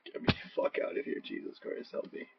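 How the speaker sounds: breathy whispered mock plea for help. Real, not acted. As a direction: beg softly in a whisper, half joking. Pleading after disturbing sight.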